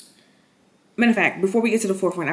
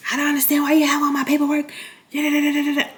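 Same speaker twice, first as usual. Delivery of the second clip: mock stepdad; 7 semitones higher, faster than usual. Stepdad voiced in retelling.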